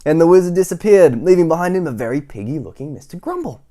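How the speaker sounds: put-on projected retake for kids; bigger retake. Shown as a demonstration. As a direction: start over with full energy. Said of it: "restart after sneeze".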